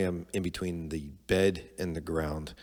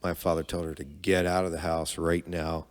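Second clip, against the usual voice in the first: usual speaking voice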